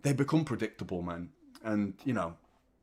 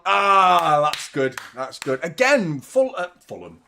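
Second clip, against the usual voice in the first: usual speaking voice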